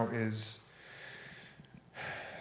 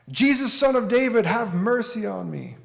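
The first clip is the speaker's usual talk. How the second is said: pleading yell, higher and louder; 9 semitones higher, 6 dB louder than usual. Retold or imitated. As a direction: cry out for mercy from the roadside. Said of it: "the man yells out".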